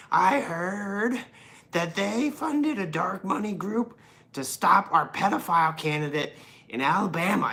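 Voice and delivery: high, loud mock-outraged politician; ranting Republican, shifting accent. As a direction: rant indignantly in a wandering put-on accent. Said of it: "my accent changed ten times".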